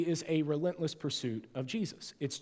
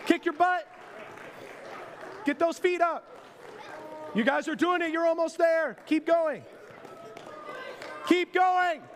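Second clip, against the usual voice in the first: aerobics instructor; 13 semitones higher than usual. Acted. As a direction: pump them up like a workout instructor.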